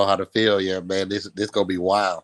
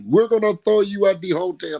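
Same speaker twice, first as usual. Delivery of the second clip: higher-pitched rowdy mimic; excited crowd threat; 9 semitones higher, 3 dB louder than usual. Friends threatening a rooftop toss, mimicked in high excitement. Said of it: "people were just like".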